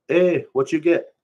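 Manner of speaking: high, loud pidgin shout from the truck; pidgin call out. Acted. Narrator calls to the skinny kid on the road, loud pidgin greeting.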